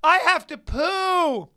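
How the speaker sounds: high, drawn-out bellow; public prank announcement. Retold or imitated. Prankster pushing his wife into a crowded theater, loud drawn-out shout. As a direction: wail it loudly across the whole room. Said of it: "and I'll go".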